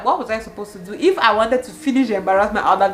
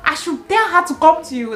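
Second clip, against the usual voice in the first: higher, louder indignant outburst; angry retort; 10 semitones higher, 5 dB louder than usual. Retold or imitated. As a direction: snap back at him, outraged. Friend angry at the man.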